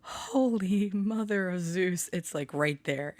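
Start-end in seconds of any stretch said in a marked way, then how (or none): 0.0-2.1 slow, stretched mock-shocked oath; mock-shocked at the spider on the wall; shocked exclamation. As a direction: drag it out in stunned disbelief. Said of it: "and I'm like".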